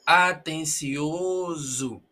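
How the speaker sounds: "Atencioso" stretched very slowly with a buzzing z; slow model of "Atencioso" with a long z; drawn-out demonstration. Shown as a demonstration. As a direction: draw out each syllable of "Atencioso", voicing the s as z. Slow drilling of the z sound.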